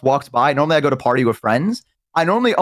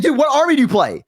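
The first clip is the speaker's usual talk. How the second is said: eager gamer; 11 semitones higher than usual. Retold or imitated. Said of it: "He's like".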